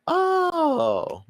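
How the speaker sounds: high-pitched, drawn-out mock "oh" of realisation. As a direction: act out a sudden revelation, very high. Acting another podcast's future realisation.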